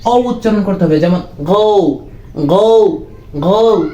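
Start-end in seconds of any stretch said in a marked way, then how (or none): 1.4-3.8 "গো" with a long rounded o gliding to u; O vowel demo. Shown as a demonstration. Teacher shows how O is pronounced now, gliding vowel demo. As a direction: drill "গো" slowly, holding the ou glide. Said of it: "এরকম উচ্চারণ হবে".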